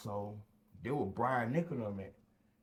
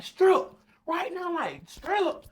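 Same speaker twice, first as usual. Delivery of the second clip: high, loud commanding shout; guard yelling at the prisoner to strip; barked order; 10 dB louder, slower than usual. Retold or imitated. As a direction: snap the order out.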